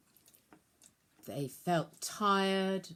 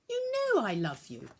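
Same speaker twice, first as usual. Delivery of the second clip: a touch of eager warmth; with enthusiasm; 5 semitones higher than usual. A narrator's reading. Peter answers Jesus, mildly enthusiastic. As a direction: answer keenly, lightly lifted. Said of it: "Peter said with enthusiasm".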